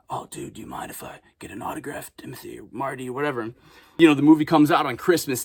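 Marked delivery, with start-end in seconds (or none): 0.1-3.5 soft hushed whisper; re-enacting the scene in a whisper; whispered reenactment. Shown as a demonstration. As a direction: whisper the fan request. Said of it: "I was acting it out".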